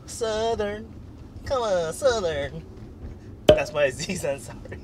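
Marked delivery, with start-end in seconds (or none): 0.1-2.6 drawn-out mock Southern twang; Southern accent. Pushing a friend to do Southern, drawling Southern accent. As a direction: coax with a stretched Southern accent. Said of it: "You have to do Southern".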